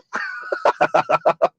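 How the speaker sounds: shrill burst of laughter; high squealing laugh. Real, not acted.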